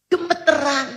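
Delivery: usual speaking voice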